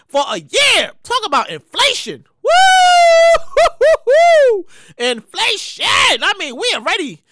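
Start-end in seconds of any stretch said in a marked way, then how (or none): 2.4-4.7 loud, high whooping woo; startled whoop at the price. Real, not acted. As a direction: let out a big whoop of disbelief. Reaction to inflation.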